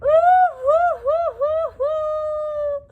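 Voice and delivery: high loud wailing sob; high loud wailing hoo hoo. Acted. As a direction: let the mock wail swell. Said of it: "He cried louder and louder".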